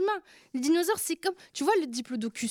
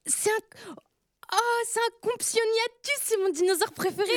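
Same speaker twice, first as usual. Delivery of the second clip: high, quick, delighted chatter; thrilled, voice jumping up; excited; 8 semitones higher than usual. Real, not acted. Receiving favourite dinosaur figurine.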